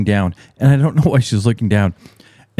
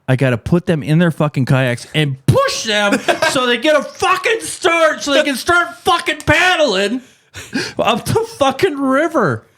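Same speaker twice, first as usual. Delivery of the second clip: exasperated. Real, not acted. Exasperated rant rising.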